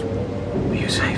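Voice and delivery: slow hushed whisper. Acted. Rochester at Jane's door in the storm, whispering. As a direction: breathe it through the door. Night talk at her door.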